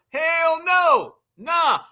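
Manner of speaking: loud, high "Hell no" shout; fired-up reaction rant, shouting no; emphatic shout. Real, not acted. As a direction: shout it like a flat refusal.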